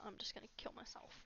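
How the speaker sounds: a soft, unvoiced whisper of despair. Real, not acted. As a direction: barely breathe the words out, hopeless. Despairing confession.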